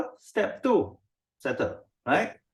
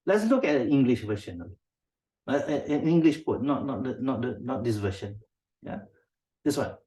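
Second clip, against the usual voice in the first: ordinary talk, nothing marked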